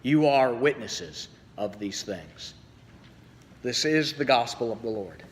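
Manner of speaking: measured, solemn liturgical reading; gospel reading. Acted. Pastor reading the gospel to the congregation, solemn and measured. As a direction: proclaim the scripture to the congregation. Reads the gospel lesson aloud.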